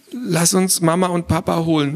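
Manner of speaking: ordinary delivery, nothing marked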